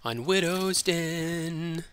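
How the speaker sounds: very slow, drawn-out map name; slow, eerie show-host drawl; spooky map announcement. Acted. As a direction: stretch it out like a haunted-house host.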